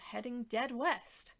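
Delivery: ordinary delivery, nothing marked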